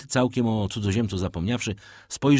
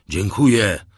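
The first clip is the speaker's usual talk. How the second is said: "dziękuję" bursts out louder, emotion breaking through; moved cry of thanks; 9 dB louder than usual. A narrator's reading. Shipowner crying out his thanks to the sailors, louder and moved. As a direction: call out "dziękuję" as the feeling overflows. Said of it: "zawołał pan Morel, wzruszony do głębi".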